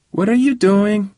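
put-on high little kid voice; child's voice. A narrator's reading. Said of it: "said a child's voice".

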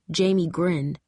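plain reading voice

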